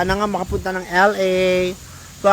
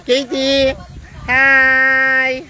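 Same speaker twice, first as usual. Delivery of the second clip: higher, drawn-out call; 5 semitones higher, much slower than usual. Real, not acted. Livestreamer hailing Katie out swimming, calling out. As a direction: sing out a call to someone far off.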